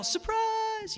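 high drawn-out yell at normal volume; mock surprise yell. Party crowd greeting the birthday friend, mock yell. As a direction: call it out high and long, imitating a party crowd. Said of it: "we're all about to yell".

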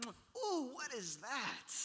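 high "ooh", then slow falling question; hammed-up recoil from a smell; mock squeamish. Father reluctantly kissing dirty son, mock squeamish.

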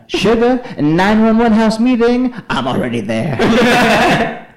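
high, loud exaggerated summons; annoying roommate. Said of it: "Sugar's roommate also talked annoyingly".

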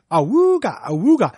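loud, high ahooga horn. Retold or imitated. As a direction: honk like a cartoon horn. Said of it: "I'm gonna be like".

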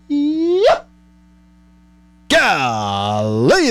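high squeaked yes, then drawn-out swooping exclamation; gushing delight. Pretend. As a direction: swoon playfully over the food. Relishing the imagined sausage dog.

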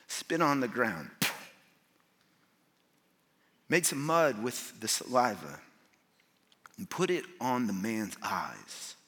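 low, measured reading with long pauses; slowed-down scripture reading. Real, not acted. Preacher slows down on verse six for the congregation, slowed-down reading. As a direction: let each phrase land slowly and softly. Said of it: "I wanna slow down on verse six".

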